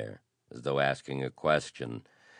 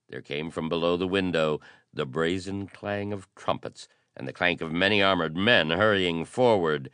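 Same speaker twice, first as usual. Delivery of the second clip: usual speaking voice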